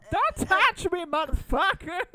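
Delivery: put-on loud, high accented outburst; Tommy Wiseau. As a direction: bellow it like an unhinged indie auteur. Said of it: "it's a Wiseau thing".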